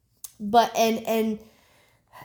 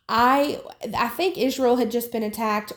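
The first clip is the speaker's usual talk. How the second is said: ordinary delivery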